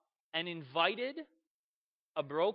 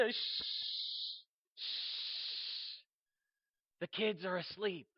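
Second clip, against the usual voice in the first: long shushes, then a hushed warning; soft parent's shushing; hushing; 6 dB quieter, much slower than usual. Acted. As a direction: quietly shush a noisy friend by a sleeping child. Parent hushing the loud friend.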